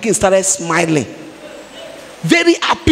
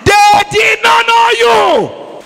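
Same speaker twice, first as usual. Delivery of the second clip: high, loud and slow, dragging each word out; preaching shout; 9 semitones higher, 4 dB louder, slower than usual. Acted. Preacher shouting to the congregation that critics don't know them, loud and slow. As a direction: cry it out from the pulpit, slowly.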